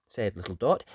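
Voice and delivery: plain reading voice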